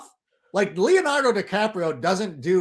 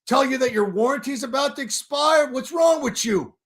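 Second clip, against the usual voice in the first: mock troll; 8 semitones higher than usual. Continuing the troll quote.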